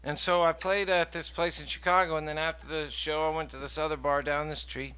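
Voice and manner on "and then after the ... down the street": ordinary delivery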